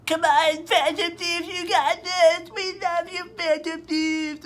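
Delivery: very high, strained mock cheer, drawn out; cheering crowd. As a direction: imitate adoring fans straining at the top of the voice. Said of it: "they're, like, cheering".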